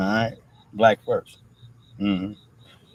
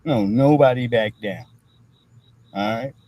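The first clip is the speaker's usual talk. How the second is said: usual speaking voice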